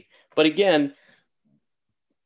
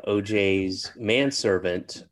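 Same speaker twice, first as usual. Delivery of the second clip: ordinary talk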